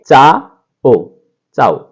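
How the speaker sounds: चा ओ split into two syllables, then चाओ run together; teaching the Italian greeting चाओ; Italian pronunciation. Shown as a demonstration. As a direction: say चा ओ slowly, then blend it into चाओ. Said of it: "पढ़ेंगे".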